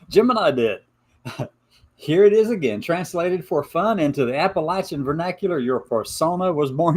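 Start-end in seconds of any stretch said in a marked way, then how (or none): none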